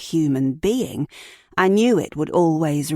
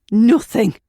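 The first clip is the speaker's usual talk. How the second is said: loud, higher furious scream; 5 semitones higher, 8 dB louder than usual. Acted. Ed screaming at his partner again, louder furious yell. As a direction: hurl the word at her. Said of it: "Ed screamed at me".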